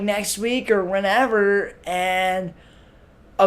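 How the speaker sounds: ordinary talk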